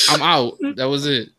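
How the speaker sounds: usual speaking voice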